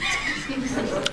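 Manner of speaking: quiet breathy chuckle; a short quiet laugh at her own joke; soft laugh. Real, not acted. Laughing quietly to the class at her own joke.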